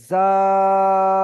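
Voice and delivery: loud, sustained "zaaaa-da" for জাদা; drawn-out madd. Shown as a demonstration. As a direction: hold জা very long, then a short "da" in জাদা. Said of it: "হুরুফে মাদ্দা টান হচ্ছে".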